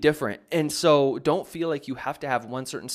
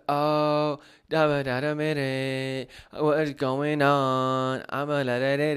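mock monotone, slow, drawn-out long syllables; 3 dB louder, slower than usual. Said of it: "very kind of strange, kind of, like, monotone".

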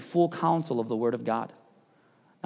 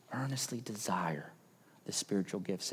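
very soft, low, near whisper; hushed appeal; 7 semitones lower, 12 dB quieter than usual. Real, not acted. Pastor urging the church from his heart, hushed. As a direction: drop to a low, intimate hush. Tender appeal after illustration.

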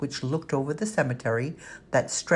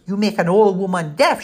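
louder, higher scolding shout; shout over banter; 5 semitones higher, 7 dB louder than usual. A narrator's reading. Housekeeper shouting over the kids' banter, loud. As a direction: shout over the noisy boys. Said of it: "she shouted over their banter".

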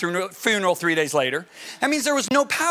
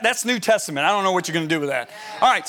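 ordinary talk

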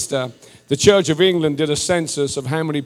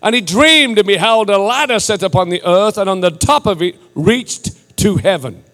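declamatory Bible reading with stretched key words; scripture reading; 4 semitones higher, 5 dB louder than usual. Real, not acted. Preacher reads Genesis from the King James, declamatory delivery. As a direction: proclaim the passage in measured, emphatic phrases.

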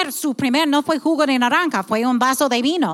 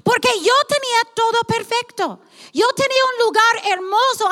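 much higher, earnest, inviting; voice of God; 9 semitones higher than usual. Pretend. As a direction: speak as God asking for the plain truth. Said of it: "Él está diciendo". (voz de Dios, aguda y sincera)

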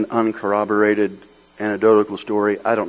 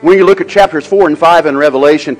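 louder, fervent preaching; rising preaching; 5 semitones higher, 10 dB louder than usual. Acted. Pastor urging the church to keep awe in worship, fervent preaching. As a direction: press the point home with fire.

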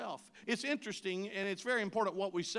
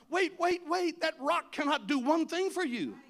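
calling out; 8 semitones higher, 7 dB louder than usual. Pretend. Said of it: "I wanted to cry out".

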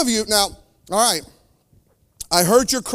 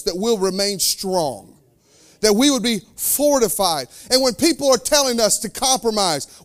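ordinary talk, nothing marked

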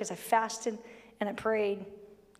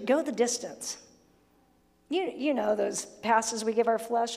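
normal talking voice

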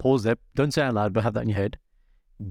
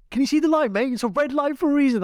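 high-pitched retold driver's rant; giving it ten. Host at a red light to honking drivers, indignant rant. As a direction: have a go at the honking cars, voice way up. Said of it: "I'm there, like, giving it ten".

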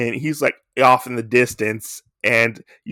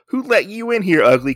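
high mock-tough jeer; hostile jeer; 10 semitones higher than usual. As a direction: jeer at the monster. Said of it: "he's like".

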